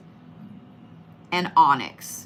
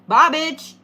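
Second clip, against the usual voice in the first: higher, sassy send-off; sassy goodbye; 4 semitones higher, 3 dB louder than usual. Pretend. Tarot reader shows Leos a sassy send-off to obstacles. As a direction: flick it away with attitude.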